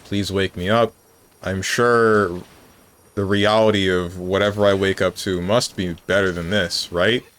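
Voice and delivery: usual speaking voice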